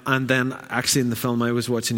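ordinary talk, nothing marked

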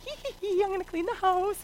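high, soft giggly scheming; mock-mischievous little secret; sneaky glee. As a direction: titter like you're getting away with something. Sneaking inside to clean, gleeful.

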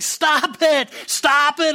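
high, pleading protest; crying out. Acted. Painted teacup begs the master, high cried plea. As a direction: cry it high, each plea separate. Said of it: "I cried".

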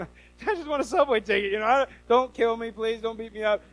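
high, hammed-up nervous begging; mock pleading. Tourist begging a New York ticket seller, mock-frightened pleading. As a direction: plead for mercy in comic panic.

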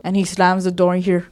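ordinary talk

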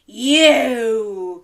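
drawn-out, booming wolf cry; loud cry. Acted. Wolf revealing breakfast to Granny, loud triumphant cry. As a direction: pounce on it very loudly. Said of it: "cried the wolf".

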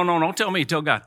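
normal talking voice

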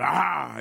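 sudden loud "ah!"; imitated jump-scare yell. Imitating people who jump out at you, sudden yell. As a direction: burst out with a haunted-house scare yell.

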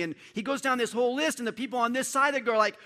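loud, high, driving sermon climax; fiery preacher at full volume; preaching at full force. Acted. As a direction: preach it out, building to a roar.

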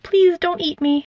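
high, small squeaky plea; a mouse begging the owl, high and squeaky; squeaked plea. A narrator's reading. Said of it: "and squeaked".

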